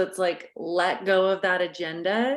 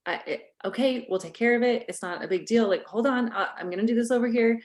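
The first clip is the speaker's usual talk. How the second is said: fast, frazzled parent chatter; rushed and hurried; 4 semitones higher, faster than usual. Shown as a demonstration. Coach showing a hurried parent's pace, fast. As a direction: rush through it, scrambling to fix. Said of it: "listening to the pace of my voice".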